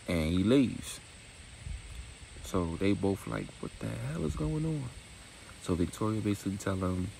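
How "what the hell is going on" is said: put-on higher confused girls.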